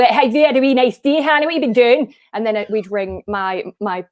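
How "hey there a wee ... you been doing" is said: higher, lively Scottish relative on the phone; put-on Scottish accent, pitched up. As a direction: imitate a chatty Scottish caller. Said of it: "you'd ring in Scotland".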